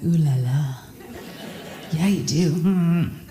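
low, seduced purr; smitten response. Acted. Woman at a party answers the French guy, low and smitten. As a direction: swoon over him in a low voice. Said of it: "You're like".

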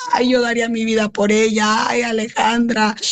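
retelling the officer's wail with a sob in it; desperate crying. Retold or imitated. Mother retells the officer crying out desperately for her daughter, sobbing. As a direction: cry it out in despair, voice breaking. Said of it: "a gritar desesperada".

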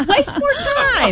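high, drawn-out sarcastic cheer; laughing mock encouragement, stretched out. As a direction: squeal it sarcastically, half laughing.

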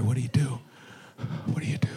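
soft whispered question; hushed question. Acted. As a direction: drop to a whisper.

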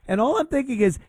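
normal talking voice

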